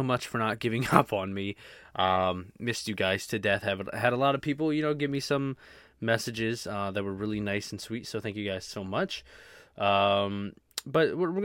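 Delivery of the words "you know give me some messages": normal talking voice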